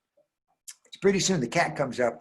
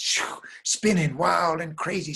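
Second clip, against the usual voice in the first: higher, stretched-out, whooshing telling; dramatic whirlwind narration; 6 semitones higher, 4 dB louder, slower than usual. A narrator's reading. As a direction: whoosh and draw out the wildness.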